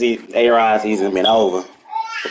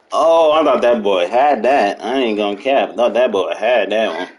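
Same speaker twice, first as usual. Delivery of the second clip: normal talking voice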